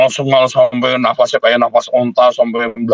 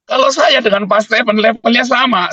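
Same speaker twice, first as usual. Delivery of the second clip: raised pitch, pressing the point; emphatic preacher peak; preaching emphasis; 11 semitones higher than usual. Real, not acted. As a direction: preach it higher and harder. Preacher building to high point.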